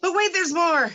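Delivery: high, loud TV pitchman; infomercial voice. Pretend. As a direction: sell it like a late-night infomercial. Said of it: "I'm doing a, infomercial".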